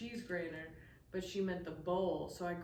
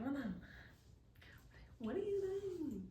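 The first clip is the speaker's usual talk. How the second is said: flabbergasted server; 8 semitones higher, 7 dB quieter than usual. Retold or imitated. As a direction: imitate a stunned waitress, pitch swooping up. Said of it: "She was flabbergasted".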